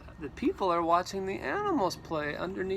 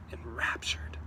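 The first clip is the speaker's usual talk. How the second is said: whispered; 7 semitones lower, 5 dB quieter than usual. A narrator's reading. Whispered story word.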